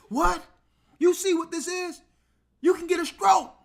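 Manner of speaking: high, loud, alarmed squawk; panicked nurse. Retold or imitated. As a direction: shriek the warning like she's horrified. Nurse panics at his reading.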